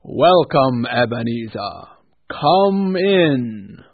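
loud, grand spirit greeting; booming ghost voice. A narrator's reading. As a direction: welcome him like a giant ghost. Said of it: "Scrooge entered the room".

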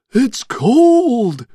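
high, loud rattly skeleton voice. A narrator's reading. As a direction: shiver it out like skeletons. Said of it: "said the skeletons".

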